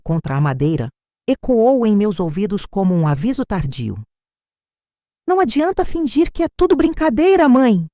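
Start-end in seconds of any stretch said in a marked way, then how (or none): 5.3-7.9 high-pitched narrated outcry; daughter's high, agitated outcry; emotional cry. A narrator's reading. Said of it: "gritou ela, a voz trêmula pela emoção descontrolada".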